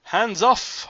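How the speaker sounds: a playful hands off, half laughing; chuckling. Acted. Coy old singer to Zorba, chuckling hands off. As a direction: push him away with a chuckle.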